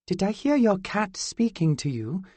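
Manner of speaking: put-on a lilting otherworldly girl, teasing; fairy girl voice. A narrator's reading.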